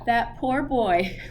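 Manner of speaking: ordinary delivery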